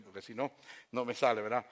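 ordinary talk

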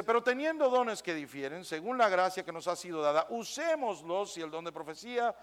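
quiet, measured Bible reading; soft scripture reading. Acted. Reads scripture aloud. (lectura bíblica suave y pausada)

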